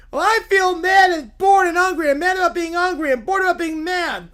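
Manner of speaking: high, loud venting rant; whiny Little Bear. Acted. Little bear expressing how we feel, loud whiny rant. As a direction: pour out every grievance in one bawling rush.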